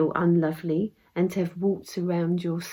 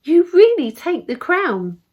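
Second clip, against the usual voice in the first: high, louder fairy praise; crying out in admiration; 7 semitones higher, 6 dB louder than usual. A narrator's reading. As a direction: proclaim it, voice lifted. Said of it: "she cried out".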